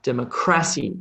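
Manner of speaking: "democracy" wrongly stressed on the third syllable, dem-o-CRA-cy. Shown as a demonstration. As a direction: put the weight on "cra" in "democracy". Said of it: "put emphasis on the cr sound".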